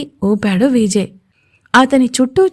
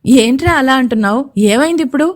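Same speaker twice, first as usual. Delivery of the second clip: plain reading voice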